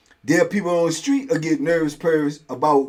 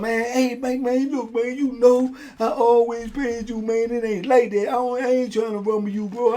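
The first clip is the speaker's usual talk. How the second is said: pleading debtor; 6 semitones higher than usual. Retold or imitated. Debtor pleading in retold jail story.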